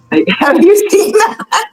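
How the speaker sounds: high, drawn-out question through laughter; laughing. Real, not acted. Host asking co-host about the singer's accent, laughing. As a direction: ask it cracking up, pitch jumping up.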